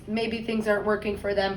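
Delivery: plain reading voice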